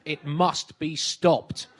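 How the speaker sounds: very slow, grave declaration; mock solemn. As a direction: pronounce it like a statesman sealing fate.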